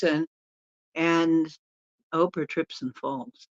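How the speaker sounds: ordinary talk, nothing marked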